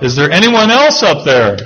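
high, hopeful call upward; calling back up. Retold or imitated. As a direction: call out for someone else, pitch climbing. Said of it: "the man calls back up".

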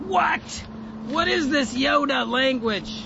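startled double take at the verse; mock bafflement. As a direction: play the puzzled listener, high. Reacting to a puzzling verse.

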